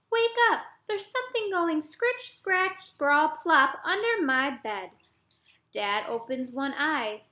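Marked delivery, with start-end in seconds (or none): 0.1-4.9 put-on high child's voice, urgent then slowing; a scared boy telling his dad about the noise; frightened little boy. Jeremy telling Dad about the noise, high urgent child voice.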